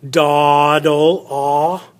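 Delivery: dawdle with the ah held and isolated; modelling the ah sound. Shown as a demonstration. Teacher isolating the ah sound for the class. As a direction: pull out the ah from dawdle. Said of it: "that's an ah sound".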